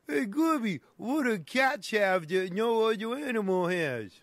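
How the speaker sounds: high sidekick voice. Acted. Comic sidekick voice asks riddle.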